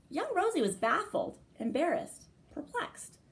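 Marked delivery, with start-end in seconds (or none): none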